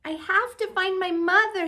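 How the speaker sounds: the little bird insists he must find her; baby bird. Acted. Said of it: "he said".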